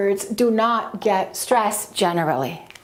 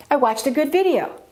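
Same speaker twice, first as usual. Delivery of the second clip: "video" stressed over "good", pitched up then falling; jump up on video; 4 semitones higher, faster than usual. Shown as a demonstration. Teacher showing nouns beat adjectives, jump up on "video". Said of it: "So we jump up like this".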